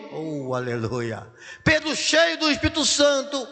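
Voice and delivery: usual speaking voice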